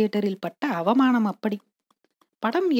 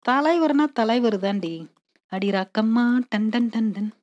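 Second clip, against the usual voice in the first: usual speaking voice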